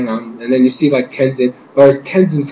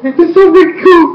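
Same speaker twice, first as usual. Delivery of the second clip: very high elated squeal; so happy; 13 semitones higher than usual. Retold or imitated. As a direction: re-enact a fan's victory cheer. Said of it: "I was so happy".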